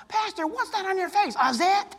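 put-on high child's voice; a cheeky kid asking; little kid voice. Kid questioning the pastor, high child voice. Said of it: "He didn't have any inhibitions".

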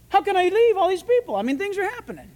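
high-pitched, pleading objection; mock protest. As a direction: act out a worked-up protest to God. Acting Philip's imagined protest to God.

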